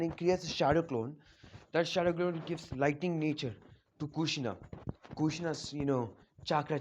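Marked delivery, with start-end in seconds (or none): none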